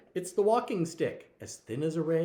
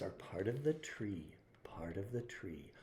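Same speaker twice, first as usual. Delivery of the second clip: whispering; 5 semitones lower, 11 dB quieter than usual. A narrator's reading. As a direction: breathe the words to the little one. Reads refrain to child.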